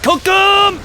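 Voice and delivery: high, loud battle cry; shrieked cook 'em; gleeful shout. Real, not acted. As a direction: cheer the hit on. Cheering his attack.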